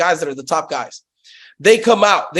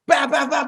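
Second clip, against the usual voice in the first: shouted treadmill noise; 5 semitones higher than usual. Retold or imitated. Telling the podcast host about racing at Planet Fitness, shouting the noise. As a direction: belt out the thud of the treadmill.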